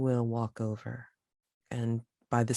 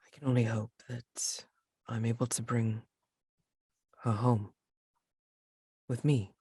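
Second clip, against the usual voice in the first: subdued character reply. Acted. Plays grieving character answering.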